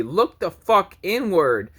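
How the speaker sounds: normal talking voice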